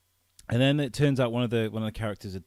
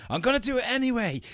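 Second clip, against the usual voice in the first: high, mocking stubborn boast; reckless hero; 13 semitones higher, 4 dB louder, faster than usual. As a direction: ham up a defiant hero. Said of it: "He's like".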